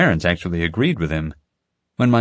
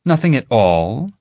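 higher, slow disbelieving question; incredulous; 5 semitones higher, 4 dB louder than usual. A narrator's reading. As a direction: let the doubt show.

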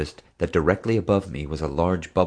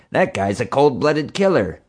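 higher-pitched character voice, lightly put on; a hint of another woman's higher voice; Marge; 6 semitones higher than usual. A narrator's reading.

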